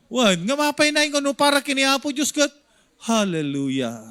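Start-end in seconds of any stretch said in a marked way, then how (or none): 3.0-4.0 sleepy mock hallelujah. Pastor mimics a sleepy hallelujah.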